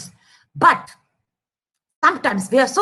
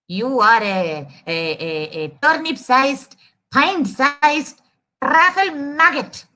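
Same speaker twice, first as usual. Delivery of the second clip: fumbling elephant. Acted. Elephant searching for an insult back, slow and fumbling.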